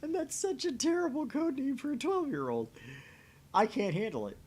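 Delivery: ordinary delivery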